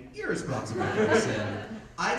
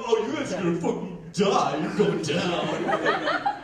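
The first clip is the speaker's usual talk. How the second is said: threatening; 2 semitones higher, 4 dB louder than usual. Retold or imitated. Friends threaten a fight.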